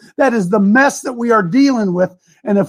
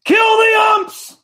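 mock crowd yell; 7 semitones higher, much slower than usual. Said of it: "Today you yell".

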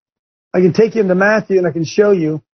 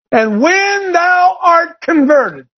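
high, slow shouted scripture line; preaching shout; 10 semitones higher, much slower than usual. Real, not acted. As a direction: belt it out like a pulpit climax. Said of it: "preacher shouts scripture".

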